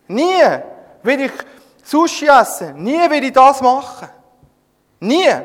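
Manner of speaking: laut und entrüstet, höher im Ton; empörte Weigerung. Acted. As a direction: Petrus wehrt sich lautstark gegen das Essen. Petrus weigert sich empört. (empörtes lautes Nein)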